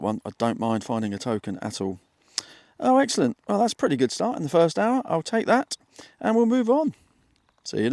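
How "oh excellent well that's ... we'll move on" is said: high, bright burst of delight; thrilled at the find, pitched way up; breathless treasure hunter. Real, not acted. Finds a coin while detecting.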